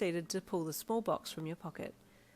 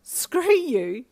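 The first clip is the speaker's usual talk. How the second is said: snorting; 8 semitones higher, 12 dB louder than usual. A narrator's reading. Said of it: "you snorted".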